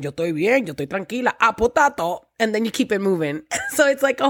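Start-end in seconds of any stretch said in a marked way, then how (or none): 0.0-2.3 casual Spanglish back-and-forth; Spanglish accent. Shown as a demonstration. Guest chatting with her friends, relaxed Spanglish accent. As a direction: switch easily between English and accented Spanish. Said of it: "I speak Spanglish".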